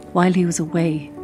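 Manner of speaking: usual speaking voice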